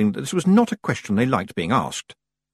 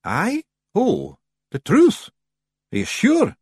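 high, slow, nervous Scots voice; hesitant high Feagle question; rather nervous; 11 semitones higher, 3 dB louder, slower than usual. A narrator's reading. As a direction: venture it nervously as a wee Scotsman. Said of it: "Rob ventured rather nervously".

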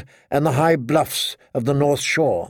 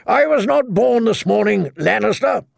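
high-pitched scornful retort; scoffing old knight; 7 semitones higher than usual. A narrator's reading. Old knight refusing Lannister's trick, scoffing. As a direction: throw it back, voice pitched up.

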